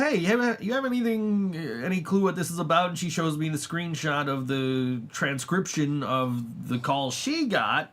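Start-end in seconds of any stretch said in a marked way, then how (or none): 0.0-2.9 lightly voiced daughter, higher and curious; a hint of a puzzled daughter asking; daughter's worried text. Retelling daughter's text to viewers, lightly higher and puzzled. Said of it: "my daughter texts me and she says".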